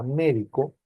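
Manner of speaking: "médico" stressed on MÉ; stress on first syllable. Shown as a demonstration. Teacher names the doctor word, "médico" stressed on MÉ. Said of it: "lleva tilde en la E".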